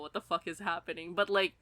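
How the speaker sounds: ordinary delivery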